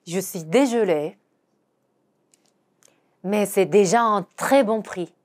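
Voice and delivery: slow apologetic French; slow teaching read; slow demo pronunciation. Shown as a demonstration. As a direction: model the seller's line slowly. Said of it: "이렇게 말하는 거죠".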